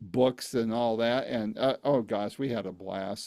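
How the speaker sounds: ordinary talk, nothing marked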